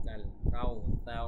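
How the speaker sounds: কাউসার slow and loud with a careful th for স; careful tha sound. Shown as a demonstration. Teacher has students watch the tha, careful slow কাউসার. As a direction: say কাল, কাউসার slowly, word by word. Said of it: "সায়ের উচ্চারণটা ঠিক মতো করবেন".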